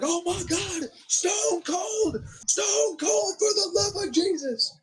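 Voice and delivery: high excited commentator impression; imitating Jim Ross. S0 imitating Jim Ross calling Stone Cold's entrance, high and excited. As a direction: squeal it like a frantic announcer. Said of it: "He's like".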